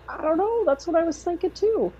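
high, bewildered voice; shrugging along in confusion; baffled agreement. Retold or imitated. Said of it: "And I'm like".

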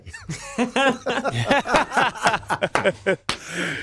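a long high burst of real laughter. Real, not acted. Laughing at the trainer's dry remark, real laughter. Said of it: "And I just started laughing".

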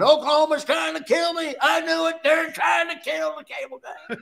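high, laughing mock alarm; mock outrage. Retold joking exclamation.

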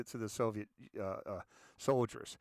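normal talking voice

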